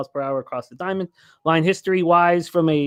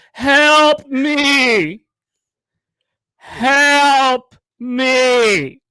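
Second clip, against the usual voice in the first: high, loud pleading yell; comic helpless shout from the bathroom; mock cry for help; 8 semitones higher, 6 dB louder, much slower than usual. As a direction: wail for help from the toilet. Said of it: "and he would go".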